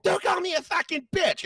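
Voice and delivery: angry wife mimicked in a high squeaky voice; mocking the wife. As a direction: squeal it like an offended woman. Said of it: "she's like".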